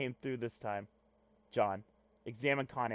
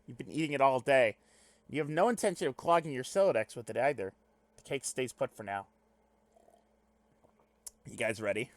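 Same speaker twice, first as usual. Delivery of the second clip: ordinary talk, nothing marked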